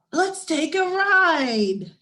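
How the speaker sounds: put-on high, slow, coaxing child voice; eager little brother. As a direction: tempt her along like an excited kid. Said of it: "Phoebe said".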